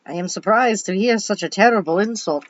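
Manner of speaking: a light Transylvanian accent attempt. Shown as a demonstration. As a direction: test a Transylvanian teacher voice on the line. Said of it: "let's try this out".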